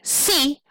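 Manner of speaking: "C" said short and high; unstressed SEA. Shown as a demonstration. Teacher testing how a syllable sounds, short unstressed "C". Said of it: "we don't say "C" like that".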